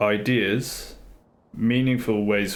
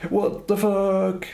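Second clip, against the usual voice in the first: high, slow, drawn-out disbelief; stunned disbelief; 11 semitones higher, much slower than usual. Retold or imitated. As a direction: stretch it out, aghast. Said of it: "Instead of going".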